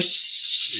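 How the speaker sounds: long drawn-out hushing "shh"; drawn-out shush; imitated shush. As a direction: shush someone at length, as Marge. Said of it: "Marge is even like".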